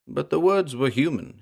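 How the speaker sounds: ordinary delivery, nothing marked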